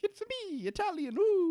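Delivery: very high squeaky Mario voice; exaggerated cheerful Italian plumber. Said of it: "codified Mario as, like, being like".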